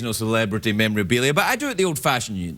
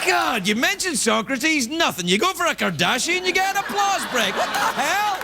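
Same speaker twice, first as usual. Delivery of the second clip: mock indignation; 13 semitones higher than usual. Mock outrage at audience.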